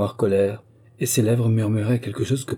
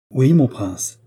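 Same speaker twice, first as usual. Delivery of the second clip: loud, higher, soldierly reply; full voice again; 5 semitones higher, 7 dB louder, slower than usual. Acted. Gardener again answering the prince at full voice. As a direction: bark it out at attention. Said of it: "encore à pleine voix".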